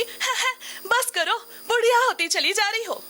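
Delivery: high, loud mocking jibe; teasing husband. Husband teases wife about getting old, loud mocking banter. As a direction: imitate a husband poking fun. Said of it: "पतिदेव बोल देते हैं ठिठोली में".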